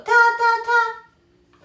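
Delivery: "ta" repeated high and level, almost sung; Mandarin first tone. Shown as a demonstration. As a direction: hold each "ta" on the same high note. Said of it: "lleva el primer tono". ("ta" en primer tono, agudo y parejo)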